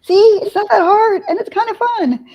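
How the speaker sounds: a bright vindicated see; triumphant told-you-so. Retold or imitated. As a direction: sing it out like you won the bet. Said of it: "I'm like".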